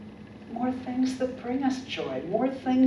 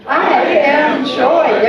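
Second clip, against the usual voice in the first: loud, high, joyful declaration; 6 semitones higher, 15 dB louder, much slower than usual. Shown as a demonstration. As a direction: shout it with real joy. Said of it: "say it like you're really joyful".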